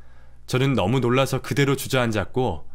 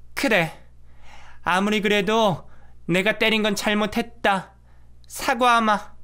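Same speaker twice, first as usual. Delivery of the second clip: put-on higher, stiff old-woman voice apologising; forced apology through pride; grudging mother-in-law; 8 semitones higher than usual. (마지못해 사과하는 시어머니)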